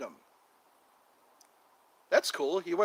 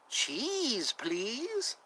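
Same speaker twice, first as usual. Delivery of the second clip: higher little mouse begging for cheese; squeaky mouse voice; 6 semitones higher, 3 dB quieter than usual. Pretend. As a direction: play a tiny pleading mouse. Voicing the mouse.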